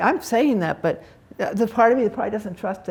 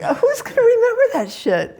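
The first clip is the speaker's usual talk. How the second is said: high, laughing, louder; laughing mock quote; 5 dB louder than usual. Retelling a Zen master's last words, laughing high and loud. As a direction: toss it off with a laugh.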